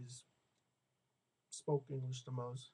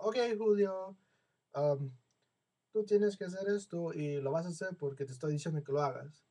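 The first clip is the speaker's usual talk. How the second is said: a mildly imitated counselor barking an order in Spanish, louder; bossy order; 4 semitones higher, 6 dB louder than usual. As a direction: give the command like a stern counselor who expects obedience. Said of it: "The first one was an order".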